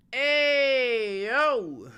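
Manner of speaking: high, loud drawn-out call. Pretend. Streamer calling out to his stream, loud holler. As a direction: holler it long.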